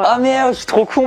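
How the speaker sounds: high exclamation of realization; real exasperated realization; sudden self-reproach. Real, not acted. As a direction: kick yourself aloud, pitched high. Realizes the answer too late.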